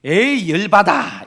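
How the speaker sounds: a loud, slow, exasperated blurt; the husband blows up at the riddle, loud; angry outburst. Retold or imitated. Gruff husband snapping at his wife, loud and slow. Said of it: "또 화가 나가지고".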